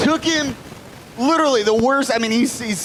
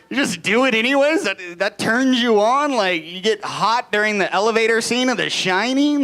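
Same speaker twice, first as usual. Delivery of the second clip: higher-pitched disbelief, rising at the end; incredulous questioning; 3 semitones higher than usual. Retold or imitated. Comedian questioning his buddy's admission, incredulous. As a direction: throw it back at him, appalled.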